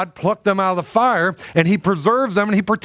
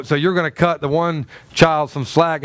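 ordinary delivery

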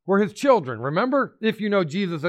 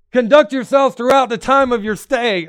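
higher and louder, pressing every word; forceful reading; 6 semitones higher, 7 dB louder than usual. Acted. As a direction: proclaim the verse with full force. Verse reread with force.

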